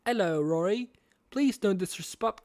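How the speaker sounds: hammed-up British accent; William Afton. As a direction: put on a British villain voice. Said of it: "do my best William Afton impression".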